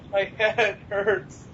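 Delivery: ordinary talk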